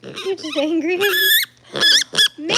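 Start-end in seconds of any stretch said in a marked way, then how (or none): 1.7-2.3 high sharp gasp of surprise; startled gasp at her pig; sharp surprised gasp. Real, not acted. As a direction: suck in air, startled. Pig suddenly does something.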